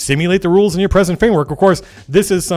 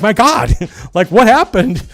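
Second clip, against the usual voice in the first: higher, louder, slowed "what happened" with a laugh; mock astonishment; 7 semitones higher, 6 dB louder, slower than usual. Host recalls hearing the new developers, mock astonished. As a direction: act amazed at the change. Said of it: "And I'm like".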